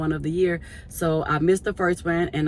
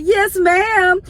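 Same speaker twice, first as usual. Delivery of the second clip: mock polite answer; 12 semitones higher, 8 dB louder than usual. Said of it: "I was like".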